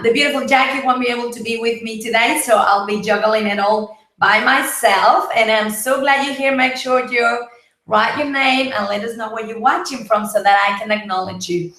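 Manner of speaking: ordinary talk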